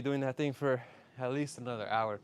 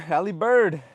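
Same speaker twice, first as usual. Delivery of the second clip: loud, raised call of a name; loud shout-out; 4 semitones higher, 9 dB louder than usual. Real, not acted. Shout-out to chat.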